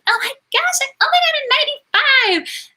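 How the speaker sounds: very high, whiny mock brag; mocking rude classmates. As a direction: squeak it out like a snobby classmate complaining about a ninety-five. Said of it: "rude girls in my class who were like".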